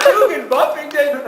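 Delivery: high, mock-disbelieving question; incredulous echo. Visitor hearing the host's odd name, incredulous. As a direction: repeat the name up high as if it's a prank.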